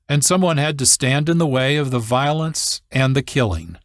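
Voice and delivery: ordinary delivery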